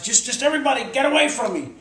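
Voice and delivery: high, loud mock protest; exasperated retold order. Storyteller voices injured man shooing helpers, exasperated loud protest. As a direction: fend off unwanted helpers.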